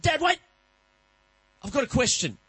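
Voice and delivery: put-on high, loud little boy pleading; a kid stopping dad at the door; child's voice. Son calling dad back at bedtime, high childish plea.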